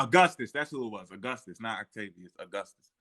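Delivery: ordinary talk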